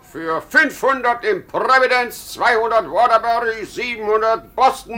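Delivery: boastful. Acted. Boasting of sales.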